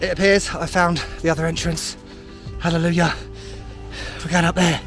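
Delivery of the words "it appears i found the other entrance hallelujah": normal talking voice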